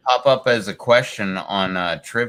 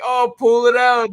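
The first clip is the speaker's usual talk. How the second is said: much higher character voice; quoting Aldous; 14 semitones higher than usual. Retold or imitated. Friend retelling a movie scene, high panicked mock voice. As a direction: squeal it like a panicked rocker. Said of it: "He's like".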